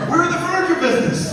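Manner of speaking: high, loud excited proclamation; acted wife's exuberant reveal; triumphant announcement. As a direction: declare it like great news. Wife announces new business excitedly.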